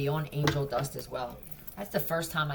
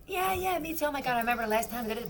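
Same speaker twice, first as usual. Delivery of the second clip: much higher, fast fake eager voice; mock chiming in; 8 semitones higher, faster than usual. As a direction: rattle it off like a fake friend. Mimicking fake chiming-in friend.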